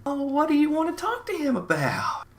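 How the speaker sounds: put-on very high falsetto old lady; pry in a high, put-on old-woman voice; older woman. Nosy older woman questioning the boys, high mock old-lady voice. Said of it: "there's this older woman".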